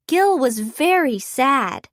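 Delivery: plain reading voice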